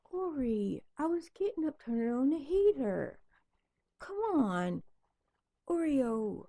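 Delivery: high coaxing talk to the dog; pet voice. Real, not acted. Talking to the dog.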